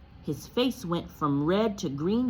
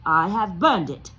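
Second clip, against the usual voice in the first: short, louder; curt; 9 dB louder than usual. Acted. Uncle Vernon to Harry, curt. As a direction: cut it off. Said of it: "said Uncle Vernon shortly".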